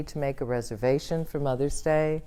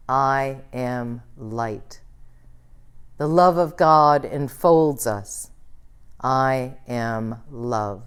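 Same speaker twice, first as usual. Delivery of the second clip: slow call and response; 2 semitones lower, slower than usual. Shown as a demonstration. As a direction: lead the room slowly, line by line. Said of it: "I'll say it slowly".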